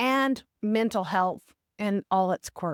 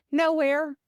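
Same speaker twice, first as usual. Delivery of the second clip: playful reply; 7 semitones higher, 3 dB louder than usual. Retold or imitated. Quoting Jenny's playful reply.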